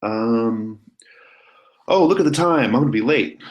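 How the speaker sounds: suddenly high, loud fake excuse; flustered escape. Ben to Jonah, making a panicked excuse to leave. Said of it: "He's like".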